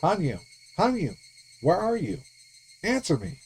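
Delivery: high, urgent calls into the dark; calling out. Acted. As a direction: call for her, anxious and pleading. Rika calling out in storehouse.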